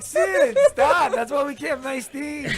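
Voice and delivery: hammed-up exasperated parent yelling at Sid; mock scolding. Friend pretending to scold Sid in front of everyone. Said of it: "I would've been like".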